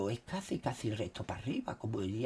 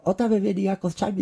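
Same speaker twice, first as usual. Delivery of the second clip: higher, louder mock indignant reply; 8 semitones higher, 12 dB louder than usual. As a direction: play up the annoyance. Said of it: "le digo".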